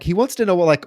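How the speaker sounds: ordinary delivery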